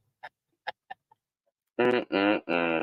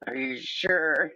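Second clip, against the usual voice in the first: higher, slow and skeptical; doubtful mock question; 6 semitones higher, slower than usual. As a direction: stretch it out, not buying it. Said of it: "And I'm like".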